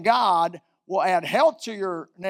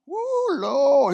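awed exclamation; 7 semitones higher than usual. Real, not acted. Awed exclamation about a lion.

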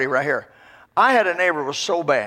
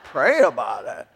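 low, chuckling echo of the advice; laughing repeat; 5 semitones lower, slower than usual. Real, not acted. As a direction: laugh at the obvious idea. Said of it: "I hadn't even thought of that".

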